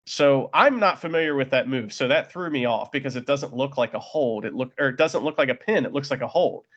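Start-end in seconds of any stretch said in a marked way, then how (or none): none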